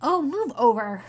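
exasperated snarl. Acted. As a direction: shove them aside with your voice.

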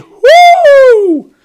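high, loud sarcastic whoop. Real, not acted. Podcaster ranting about baby accounts, a loud high whoop. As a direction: let out a big mocking woo.

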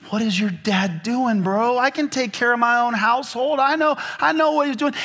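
put-on whiny offended young husband; younger self upset. Young husband protesting to his wife about her dad. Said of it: "and I was like".

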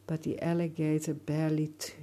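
plain reading voice

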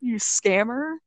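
high, taunting, disbelieving; mocking. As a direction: laugh it off at them. Mocking the scammer.

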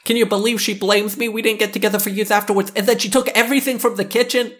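high, fast aggrieved woman voice; mocking whiny woman. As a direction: mimic a wronged wife, high and fast.

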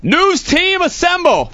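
high, slow heroic call-to-arms; mock rallying call. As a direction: summon the troops in a stretched high cry. Mock rallying call before conch blast.

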